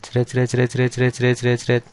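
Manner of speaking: a quick "jret" scratch for each line drawn; drawing sound effect. Shown as a demonstration. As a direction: sketch lines with little scratchy noises. Drawing lines on the board.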